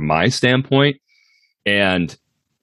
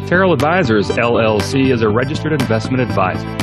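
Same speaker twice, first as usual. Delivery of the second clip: formal disclaimer read over music; fast legal disclaimer; 4 semitones higher than usual. Acted. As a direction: read the legal fine print evenly.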